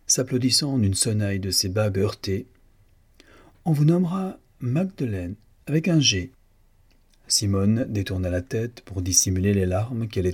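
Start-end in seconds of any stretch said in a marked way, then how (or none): 3.7-6.3 high-pitched, pleased announcement; proclamation satisfaite. A narrator's reading. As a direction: proclaim the new name from on high. Boss proclaims the new name. (annonce triomphante, haut perchée)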